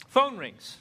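high, loud ringing sound; phone ring imitation.

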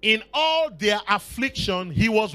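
reading scripture slowly with weight on all; slow emphatic reading. Shown as a demonstration. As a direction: lean on all, drawn out. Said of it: "I read".

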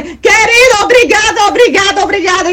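high, gushing repeated thanks; wildly grateful. Retold or imitated. Drunk woman thanking the wallet's finder, wildly grateful. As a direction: gush with thanks, imitating drunken gratitude. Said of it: "uma coisa de gratidão louca".

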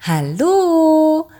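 hohes, lautes Hallo durch die Tür; laut gerufen. A narrator's reading. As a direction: ruf laut an der Tür. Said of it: "rief sie laut". (kleine Hexe ruft laut und hoch Hallo)

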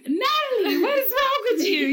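high, laughing mock alarm; half-laughing, as if stunned by her; laughing disbelief. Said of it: "So I'm like".

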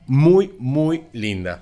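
drawn-out slow praise; slow gushing. Real, not acted. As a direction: stretch it out lovingly.

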